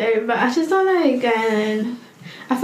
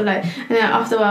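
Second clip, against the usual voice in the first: normal talking voice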